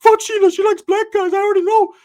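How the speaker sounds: way up high and loud, cocky friend bragging; loud high cartoonish bro voice; high-pitched Ricky voice. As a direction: squeak it out like a boastful buddy. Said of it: "He's like".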